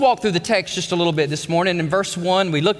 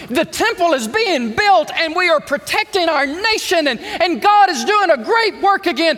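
full-force preaching; 9 semitones higher, 4 dB louder than usual. Real, not acted. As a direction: drive it home to the congregation. Preaching climax.

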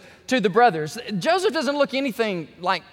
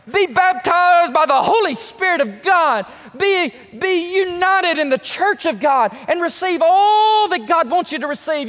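loud, higher, earnest prayer; fervent prayer; 7 semitones higher, 5 dB louder than usual. Acted. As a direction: pray it out loud with conviction. Models a sinner's prayer.

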